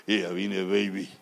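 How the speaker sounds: deep-ish macho greeting; low tough-guy greeting; Terminator voice. Retold or imitated. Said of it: "Puro Terminator".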